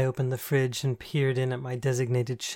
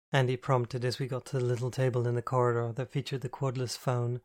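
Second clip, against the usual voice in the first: usual speaking voice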